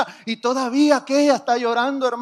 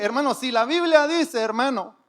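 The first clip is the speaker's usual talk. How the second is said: ordinary talk, nothing marked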